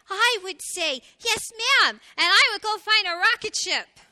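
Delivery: high, loud and beaming; excited little hedgehog. A narrator's reading. Little hedgehog answering his mother, excited. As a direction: burst out with eager excitement. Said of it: "beamed with excitement".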